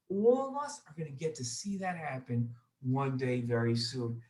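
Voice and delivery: put-on storytelling to kids; lively storyteller voice for children; story narration. A narrator's reading. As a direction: tell it gently like a children's story.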